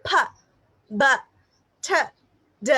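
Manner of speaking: P, B, T, D each said as a short, separate sound; isolated phonemes. Shown as a demonstration. Teacher showing trainees single sounds, isolated phonemes. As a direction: pop out P, B, T, D one by one with pauses. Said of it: "how are we going to pronounce this one".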